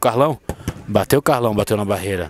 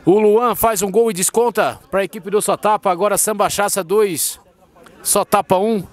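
explosive goal commentary; 8 semitones higher than usual. Real, not acted. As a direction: erupt as the ball hits the net. Goal scored, commentator erupts.

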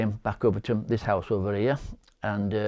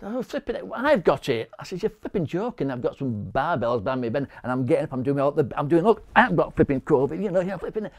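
incredulous scoffing; 6 semitones higher than usual. Retold or imitated. Told he has covid, scoffing.

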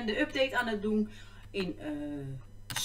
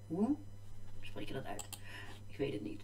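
quiet; 4 semitones lower, 11 dB quieter than usual. Real, not acted. Muttering to herself.

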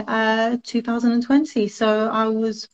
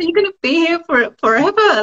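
higher, mock-incredulous teasing of friends; playful mock disbelief at friends retaking years; 7 semitones higher than usual. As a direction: rib them as if shocked. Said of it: "and I'm like".

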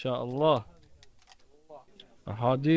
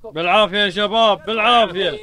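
calling out; 5 semitones higher, 7 dB louder than usual. Real, not acted. Calling out to the cooks.

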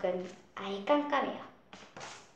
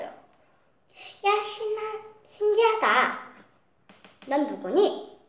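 훨씬 높고 크게 들뜬 목소리; 신기해서 들뜬 아이처럼; 13 semitones higher, 4 dB louder, much slower than usual. Acted. 빛나는 물고기를 보고 신남. (아주 높고 크게 들떠서)

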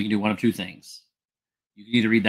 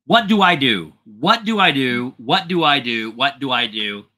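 loud, then fading, frantic plea; panicked repeating; 2 semitones higher, 7 dB louder than usual. Retold or imitated. Retelling her panicked repeated cry.

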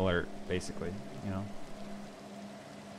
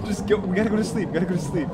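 high, pressing plea; urgent insistence; 7 semitones higher, faster than usual. Real, not acted. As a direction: push them along in a raised, higher voice. Urging partner to bed.

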